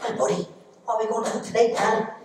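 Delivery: high, quick and eager; excited little kid. Acted. Little boy asking his brother for mischief, excited. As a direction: ask it like an eager little puppy. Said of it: "excited like a little puppy dog".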